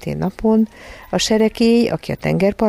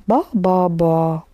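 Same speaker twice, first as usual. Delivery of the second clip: lightly voiced infant babbling; a hint of baby voice; baby babble; much slower than usual. A narrator's reading. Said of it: "mondta Barbara".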